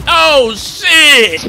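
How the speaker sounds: high, startled yell; shocked shout. Real, not acted. Player reacting to a sudden attack, shocked high shout. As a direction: cry out in alarm at the surprise.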